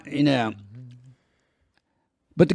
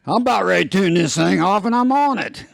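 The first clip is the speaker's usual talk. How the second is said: put-on much higher cranky old-timer; 9 semitones higher than usual. Host teases his viewers in a high old-timer voice. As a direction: scold the viewers like a grumpy old man.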